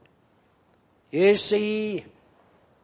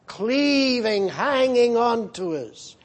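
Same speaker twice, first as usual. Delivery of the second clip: ordinary talk, nothing marked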